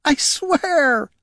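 high-pitched tearful wail; very high, crying plea; sobbing. Acted. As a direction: sob it out as a desperate oath. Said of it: "sobbed Jelana".

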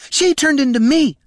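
high, loud accusation; indignant exclamation. A narrator's reading. Impostor accusing Jelana before the dwarf, high indignant exclamation. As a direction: exclaim it with the voice shooting up. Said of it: "exclaimed her double".

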